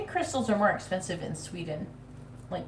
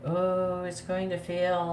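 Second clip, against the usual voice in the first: slow, droning gloomy mock voice; imitating doubtful Sean; much slower than usual. Reader imitating her doubting partner, in a slow gloomy drone. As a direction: drag it out like a pessimistic partner. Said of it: "Sean was very, like".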